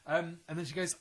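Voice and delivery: ordinary talk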